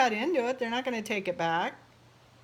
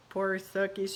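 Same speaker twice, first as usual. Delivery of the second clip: ordinary delivery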